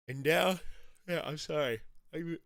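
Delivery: talking through a yawn; mid-yawn, words stretched out. Real, not acted. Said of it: "I'm yawning way too much this morning".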